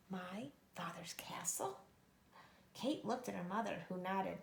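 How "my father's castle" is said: soft breathless whisper; stunned whisper. Acted. Kate learning of her father's castle, stunned whisper. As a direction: barely voice it in shock.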